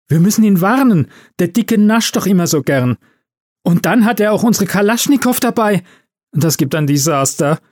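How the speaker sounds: high-pitched alarmed warning; panicked. A narrator's reading. Elf urging her colleague to warn Santa, panicked. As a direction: fret urgently about a coming disaster.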